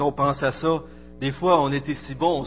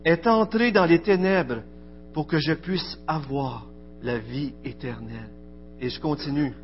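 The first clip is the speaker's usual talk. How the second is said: lecture recueillie; 3 semitones higher than usual. Acted. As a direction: read it like a litany over music, rising then fading. Recites a devotional text over music. (lecture solennelle et recueillie sur fond de musique)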